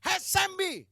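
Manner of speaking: high, loud mock-demonic call; retold spirit's call. Spirit in a woman hailing the preacher, retold loud call. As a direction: shout it out as the spirit hailing him. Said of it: "Dia bilang".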